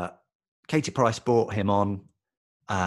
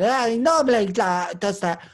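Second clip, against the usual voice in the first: high, loud Katie Price impression; a mock Katie Price brushing it off; imitating Katie Price; 10 semitones higher, 5 dB louder than usual. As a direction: pitch it up into her breezy voice. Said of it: "Katie Price said".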